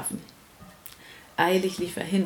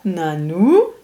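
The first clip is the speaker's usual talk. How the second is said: mock surprise; 3 semitones lower, 6 dB louder than usual. Playful repeating game with child.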